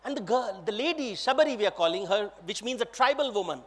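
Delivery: usual speaking voice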